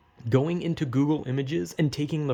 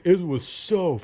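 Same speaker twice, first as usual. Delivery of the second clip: building emphatic rant; 6 semitones higher, 4 dB louder, slower than usual. Retold or imitated. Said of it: "I'm like".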